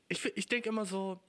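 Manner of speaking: ordinary talk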